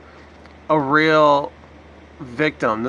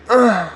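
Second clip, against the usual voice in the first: loud exasperated ugh, higher; mocking sigh; 7 semitones higher, 4 dB louder than usual. Commentator mocking the CNN anchor's sigh. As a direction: mimic the anchor's fed-up groan. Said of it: "usually it's her going".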